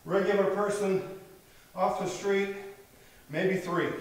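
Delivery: winded after chin-ups; breathless, catching breath. Real, not acted. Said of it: "Yep, I'm out of breath".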